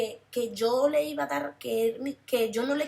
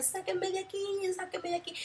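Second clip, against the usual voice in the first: much higher, thin wailing plea; mock anguished wail, higher; imitating souls' cries; 9 semitones higher, 4 dB quieter than usual. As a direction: softly mimic the burning souls' scream. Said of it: "la gente se quemaba y gritaba".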